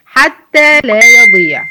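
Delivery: "حتى" stretched long and slow, then a loud drop on the doubled letter; "حتى لا يضيع" slowly, with a loud, strong hit after the long vowel; stress on the doubled letter. Shown as a demonstration. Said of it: "تطلعي المد الطويل ... وتنزلي على المشدد". (نبر قوي على الحرف المشدد بعد المد الطويل في "حتى")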